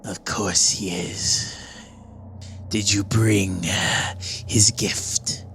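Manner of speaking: low, slow conspiratorial whisper. Acted. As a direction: breathe it like a secret behind the door. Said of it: "this kind of whispered voice goes".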